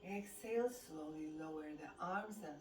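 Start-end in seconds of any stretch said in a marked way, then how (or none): none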